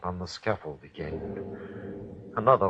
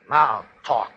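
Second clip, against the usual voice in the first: loud, sharp command to talk; a threatening shout at the bound prisoner; barked order; 5 semitones higher, 8 dB louder than usual. Acted. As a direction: force the confession out of him. Hangman demanding a confession.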